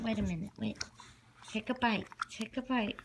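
ordinary talk

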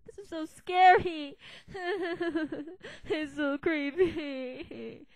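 laughing through mock fright. Real, not acted. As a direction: giggle while calling it creepy. Laughing at creepy place.

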